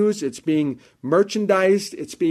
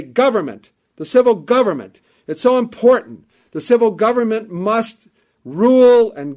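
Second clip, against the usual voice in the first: loud, raised preaching voice, high and driving; fiery preaching; 4 semitones higher, 4 dB louder than usual. Acted. Preacher warning the congregation about groups using the church, in fiery full-voiced preaching. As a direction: preach it hard from the pulpit.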